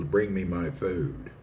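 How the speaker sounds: ordinary talk, nothing marked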